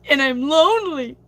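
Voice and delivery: a joking sob-story whine; mock lonely. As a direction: play the lonely card for laughs. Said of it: "I'm just kidding".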